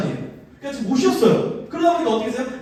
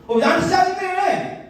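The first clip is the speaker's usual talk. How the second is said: high, drawn-out mock indignation; slow, high-pitched pretend huff; angry comeback; 10 semitones higher, slower than usual. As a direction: fire back, acting offended. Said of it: "이렇게 반응을 할 텐데".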